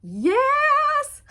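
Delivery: high squealed yes, real delight; thrilled shout at the card; excited yes. Real, not acted. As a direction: shriek it out with joy. Excited reaction to card pulled.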